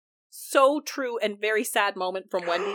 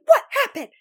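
high, loud retold outburst; hammed-up demand; 7 dB louder, faster than usual. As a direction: demand it in mock alarm, laughing. Retold Ashley demanding what happened.

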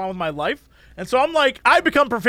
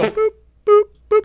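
high beeping sound; 12 semitones higher, 5 dB louder than usual. Retold or imitated. Imitating scanner.

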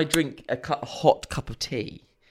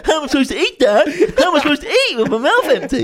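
mocking; 6 dB louder, faster than usual. Kids mocking dad at table.

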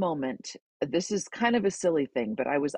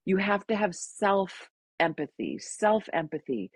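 soothing friend; 5 semitones higher than usual. Retold or imitated. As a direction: soothe a crying friend gently. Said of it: "She said".

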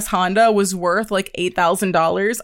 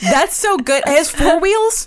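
clueless car buyer; 9 semitones higher, 4 dB louder than usual. Retold or imitated. Mocks own clueless car questions.

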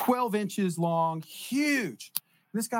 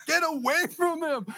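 high-pitched, drawn-out warning yell; high, stretched-out urgent cry; 10 semitones higher than usual. Retold or imitated. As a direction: call out to bystanders in danger. Retold warning to bystanders.

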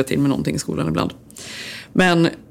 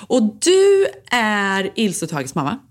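nosy mum; 4 semitones higher, much slower than usual. Retold or imitated. Mum quoted.

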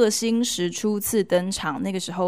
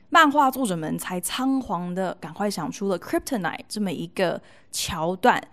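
ordinary delivery

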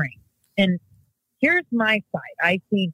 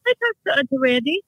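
put-on child voice; 11 semitones higher, faster than usual. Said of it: "He's like".

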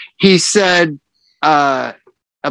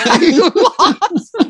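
laughing retelling; 12 semitones higher, much slower than usual. Retold or imitated. Repeats the quote, laughing.

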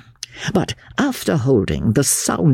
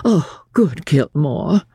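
slow, higher, indolent gentleman; languid relish; 6 semitones higher, slower than usual. A narrator's reading. Invalid sniffing his smelling bottle, slowly languid. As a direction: savour it slowly between sniffs. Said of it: "between the sniffs".

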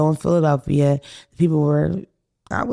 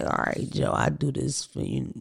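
whispering; 5 semitones lower, 9 dB quieter than usual. Retold or imitated. Grudgingly agreeing to Joe.

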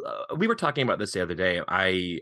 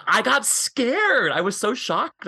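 high, drawn-out retold fright; mock-scared exclamation; 13 semitones higher, 5 dB louder, slower than usual. Podcast guest retelling an audiobook scare, hammed-up fright. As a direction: play up how scared you were, laughing, pitch way up.

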